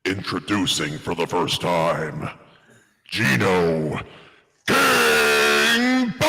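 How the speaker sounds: booming ring announcer, drawn out; wrestling announcer. Shown as a demonstration. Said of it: "We gotta do better than that".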